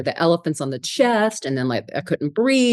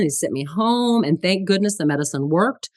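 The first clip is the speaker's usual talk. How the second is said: ordinary delivery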